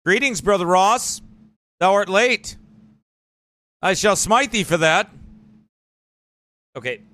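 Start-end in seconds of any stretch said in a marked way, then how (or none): 0.0-5.1 mock biblical prophet. Mock prophecy after AI talk.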